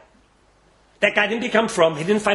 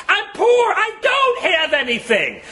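high, drawn-out cry of poverty; desperate plea; 11 semitones higher, slower than usual. Acted. Poor man praying with empty hands, pleading high. Preacher acts poor man's prayer.

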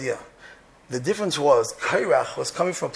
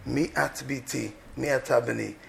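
gentle; 4 semitones lower, 7 dB quieter than usual. Shown as a demonstration. Closing gentle alternative to cursing.

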